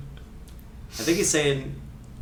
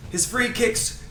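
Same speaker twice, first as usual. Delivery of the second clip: put-on Ray Hudson Scottish accent; 3 semitones higher than usual. Host reading a free kick quote, in fake Scottish accent. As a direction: fake Scottish commentator, theatrical. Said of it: "my fake Scottish accent".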